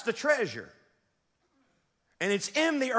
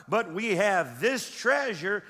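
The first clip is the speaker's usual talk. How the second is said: slow, weighty scripture read; reading aloud slowly with the congregation; slow emphatic reading; slower than usual. Shown as a demonstration. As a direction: lean on earthen and drag it out. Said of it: "Read that out loud".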